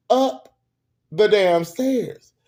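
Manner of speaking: "up" punched high and loud, then "the damn stairs" drawn out; incredulous, loud, stretched "up the damn stairs"; exasperated emphasis. Real, not acted. Mock outrage at remembered slap.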